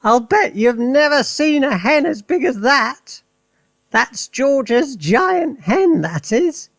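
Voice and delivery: put-on high, loud old woman's cry; triumphant Grandma, high and loud; Grandma shouting. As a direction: crow it down from the rooftop at Mary. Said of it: "Grandma shouted".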